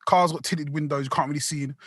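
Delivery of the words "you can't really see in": ordinary delivery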